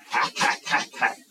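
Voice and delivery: sharp, voiceless repeated hacking noises; imitated machete chops. Preacher acting out the butcher's machete, percussive hacks. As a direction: chop out each hack like a blade landing.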